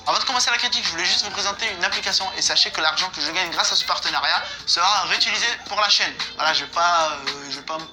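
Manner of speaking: ordinary talk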